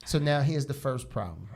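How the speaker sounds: ordinary delivery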